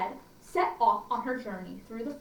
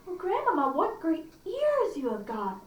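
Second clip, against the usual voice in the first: put-on girl voice; 5 semitones higher than usual. Girl voiced in read-aloud story.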